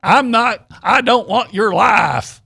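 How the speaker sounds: high, loud mock Texas accent, slowing down; fake Texas accent. Co-host mocking Van Der Beek's Varsity Blues line, loud Texas accent. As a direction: ham up a movie star's put-on Texas accent. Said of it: "do the Texas accent".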